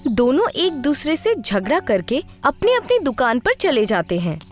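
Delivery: usual speaking voice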